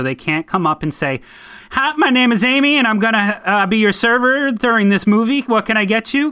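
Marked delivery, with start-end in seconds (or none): 1.7-6.3 high, loud Southern waitress voice; chirpy Southern server greeting, pitched up; Southern accent. Retold or imitated. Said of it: "Were you doing a lot of these in the South?".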